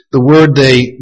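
ordinary talk

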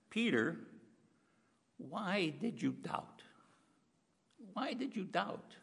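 Jesus asking Peter softly and sadly why he doubted; pained disappointment. Acted. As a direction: ask gently, full of disappointment. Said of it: "the pain in his voice".

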